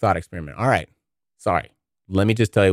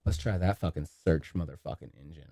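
ordinary talk, nothing marked

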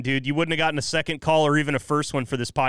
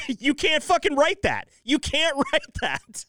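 higher, laughing disbelief; 10 semitones higher, slower than usual. Real, not acted. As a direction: crack up while saying it. Laughing at the irony.

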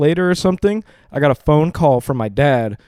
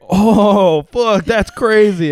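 high-pitched, drawn-out amazement; acted jaw-drop reaction; retold shock; 7 semitones higher, much slower than usual. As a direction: replay the stunned realization, slow and high. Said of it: "I was so fucking shocked".

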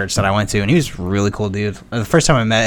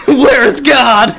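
high, laughing theatrical cries; hammy stage actor; slower than usual. Pretend. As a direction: overact a fleeing deity. Acting God hunted by priest.

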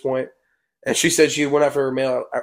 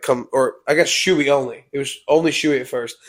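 ordinary talk, nothing marked

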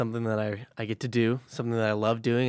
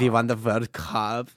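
put-on German accent; 4 dB louder, slower than usual. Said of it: "I can't even do a German accent".

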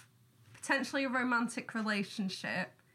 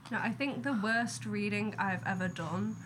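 usual speaking voice

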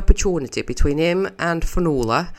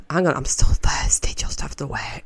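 whisper. Real, not acted. Aside while drinking.

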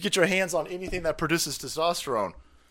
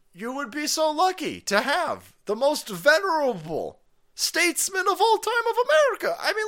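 high-pitched, hammed-up sales oratory; mock grandiose praise; 10 semitones higher than usual. Host pitching Biden to Poland, mock grandiose praise. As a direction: sing his praises with sarcastic zeal.

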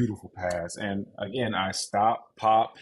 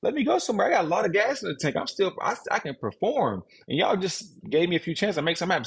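heated plea; 9 semitones higher, faster than usual. Retold or imitated. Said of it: "I'm like".

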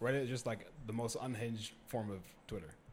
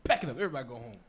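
mock shutdown order; 2 semitones higher, 8 dB louder, much faster than usual. Acting out shutting down the show.

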